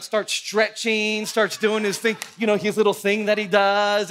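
ordinary talk

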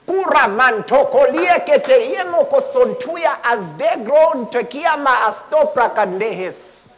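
loud, slow glossolalia; a preacher speaking in tongues. Real, not acted. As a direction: pour out the tongue with fervour.

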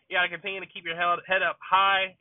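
ordinary delivery

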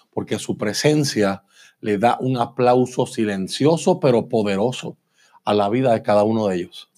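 usual speaking voice

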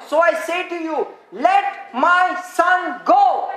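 slow, commanding stress on every word of LET MY SON GO; emphatic divine command. Acted. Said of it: "look at the emphasis".

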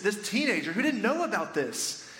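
ordinary talk, nothing marked

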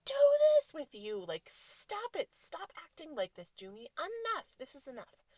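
put-on loud, high-pitched outburst at a child; high exasperated mom yell; exasperated freak-out. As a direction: reenact a mom losing it, yelling. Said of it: "I had a freak out".

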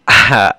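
short loud laugh; quick burst of laughter, falling off. Real, not acted. Laughs after "But yeah.

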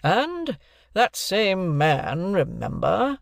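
high, very slow, weighty address; accusing oration. A narrator's reading. Stranger denounces Wopsle to the company, accusing. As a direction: draw it out as a warning to the jury.

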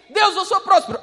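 loud, ringing cry of faith; bold declaration. Pretend. Believer proclaiming healing to God, loud and bold. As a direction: shout the claim up to God.

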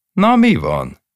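high, loud annoyed snap; the wife flares up at him; irritated snap. Acted. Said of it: "Mérgelődött fel az asszony".

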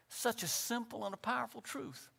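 normal talking voice

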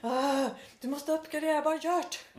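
high, exasperated mock insistence; mock upset. Imitating an engineer urging a columnist to upgrade, mock upset. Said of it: "varit lite upprörd och bara".

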